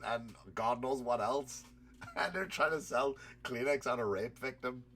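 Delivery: ordinary delivery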